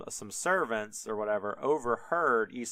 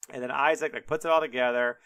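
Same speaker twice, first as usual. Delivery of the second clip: ordinary delivery, nothing marked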